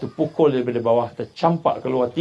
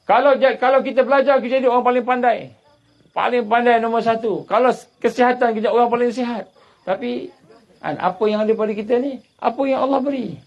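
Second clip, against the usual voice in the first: raised, driving sermon voice, building the drama; preaching at full force; 11 semitones higher, 3 dB louder than usual. Acted. Preacher to his congregation, telling of the tsunami, fired-up sermon delivery. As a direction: push the story like a fiery preacher.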